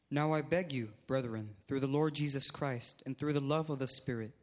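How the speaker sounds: usual speaking voice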